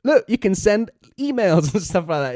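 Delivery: high, eager Norm voice with a laugh; playing Norm. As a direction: bounce up high like a gadget-thrilled Norm. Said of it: "Norm's just like".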